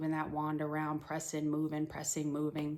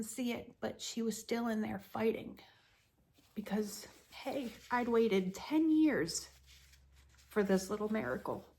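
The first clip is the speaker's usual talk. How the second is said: higher, voice strained with tears; tearful; 6 semitones higher than usual. Real, not acted. Mother recalling praying for a miracle, tearful and higher. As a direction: push through the tears, trembling.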